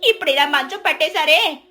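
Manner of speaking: high, lamenting neighbour voice; pitying neighbour. Acted. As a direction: commiserate with showy pity.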